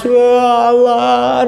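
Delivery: loud drawn-out mock cry; imitated wailing. Preacher mimics the crowd weeping by the bridge, mock wailing. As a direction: wail it out long, acting a weeper. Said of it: "itu pada nangis".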